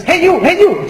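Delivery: very high squawky parrot call. Acted. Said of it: "the parrot says".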